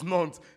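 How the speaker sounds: Nantes as one syllable, "nonts"; correct pronunciation repeated. Shown as a demonstration. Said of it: "It's Nantes".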